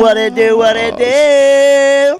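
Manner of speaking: hype greeting. Acted.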